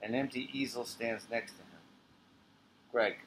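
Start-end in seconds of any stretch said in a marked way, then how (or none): none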